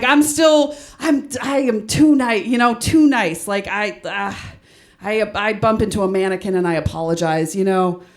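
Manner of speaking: ordinary talk, nothing marked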